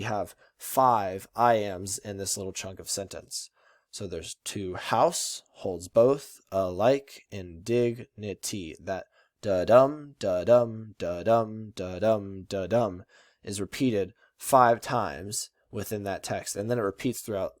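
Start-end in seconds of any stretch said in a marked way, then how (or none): 4.4-8.8 very slow "to house holds both alike in dignity", every second syllable stressed; emphasized iambic meter. Shown as a demonstration. Teacher breaks the line into five iambs, stressed slowly. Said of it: "emphasize that, that meter". 9.4-13.0 five steady beats of "da-dum", each "dum" stressed; iambic stress. Shown as a demonstration. Teacher counts five iambs for students, stressed second syllables. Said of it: "that iambic pentameter".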